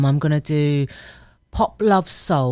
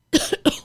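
sudden sharp cough on air; coughing fit. Real, not acted. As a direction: cough hard mid-sentence. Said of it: "I'm choking".

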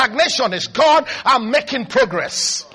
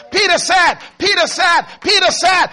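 higher, louder "Peter said" hammered; shouted repetition; 5 semitones higher, 5 dB louder than usual. Acted. Pastor hammering the phrase home to the congregation, shouted. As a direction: pound it home with the crowd. Building preaching climax.

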